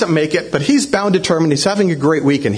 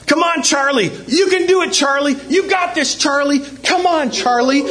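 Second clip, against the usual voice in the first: mock cheering yell; 10 semitones higher than usual. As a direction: urge on the swimmer like an excited crowd. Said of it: "yelling at the top of their lungs".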